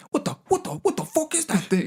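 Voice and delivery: high, shocked New York guy, cursing; New York accent. Retold or imitated. Retelling a viral video of a man spotting a cat, New York accent. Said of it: "He's, like, New York accent".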